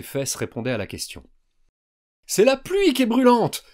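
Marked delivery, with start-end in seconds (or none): 2.3-3.6 high, loud realization; high, loud urgent cry; alarmed exclamation. A narrator's reading. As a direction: blurt it out in alarm. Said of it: "s'exclama Yuri".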